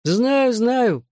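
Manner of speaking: high-pitched, humouring assent; placating agreement. A narrator's reading. Friend humours the slurring brawler, placating agreement. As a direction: agree along to placate him. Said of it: "поддакнул Хьюи".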